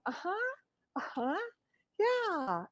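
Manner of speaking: high, soft people-pleasing uh-huhs; fawning agreement. Retold or imitated. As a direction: nod along eagerly to appease. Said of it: "Or the fawning going".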